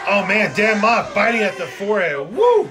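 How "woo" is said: excited whoop. Real, not acted. Commentator hypes wild spot.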